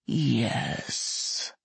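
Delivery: low whispered hiss. Acted. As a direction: hiss it like a serpent. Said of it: "hissed the dragon".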